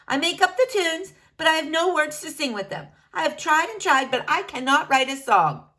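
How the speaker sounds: high, fast bean with big dreams; bubbly little bean, a bit frustrated; eager cartoon bean pouring out his problem; Bean character.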